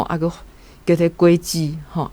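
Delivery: ordinary delivery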